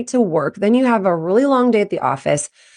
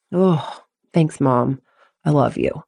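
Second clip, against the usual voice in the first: touched and relieved; 6 semitones lower, slower than usual. Acted. Athlete thanking mom for listening, relieved. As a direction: let the tension melt into thanks. Kid relieved by parent's support.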